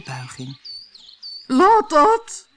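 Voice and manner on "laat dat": very high, loud shriek; shrieking queen. A narrator's reading. Queen shrieks at the bowing gardeners, very high and loud. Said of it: "gilde de koningin".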